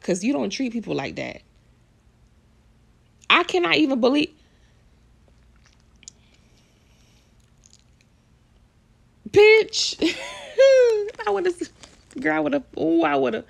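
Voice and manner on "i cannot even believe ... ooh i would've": high, squealing disbelief breaking into a laugh; can't believe it, laugh it out way up high; shocked laughing disbelief. Real, not acted. Vlogger reacting to the fan video, laughing in shock.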